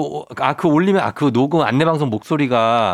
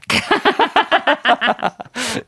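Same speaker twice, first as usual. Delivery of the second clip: laugh; 9 semitones higher than usual. Real, not acted. Host laughs.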